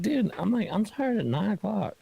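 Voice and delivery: surprised. Retold or imitated.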